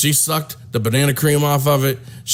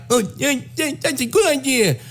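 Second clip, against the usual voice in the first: high mock voice; 10 semitones higher than usual. Man agreeing to the banana split in a high mock voice. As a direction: voice pitched way up, eager. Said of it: "Craig Donovan was like".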